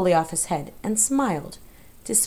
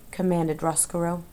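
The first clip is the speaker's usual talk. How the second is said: usual speaking voice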